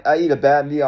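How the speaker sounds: normal talking voice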